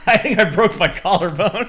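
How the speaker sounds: laughing. Retold or imitated. Injured brother tells his friend, laughing through it. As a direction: laugh while admitting the injury. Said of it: "He's laughing in my face".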